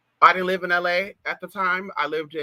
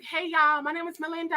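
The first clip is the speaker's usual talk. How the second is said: much higher, bubbly put-on greeting; girly chat line voice; 11 semitones higher, 4 dB quieter than usual. Imitating a chat line girl's much higher bubbly hello. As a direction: chirp it out like a chat line girl.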